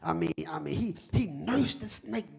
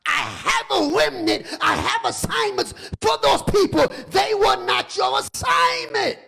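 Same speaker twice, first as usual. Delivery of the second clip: booming, higher voice of God, half sung; God's voice; 10 semitones higher, 10 dB louder than usual. Acted. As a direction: rebuke like the Almighty himself. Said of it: "you go on to God and goes".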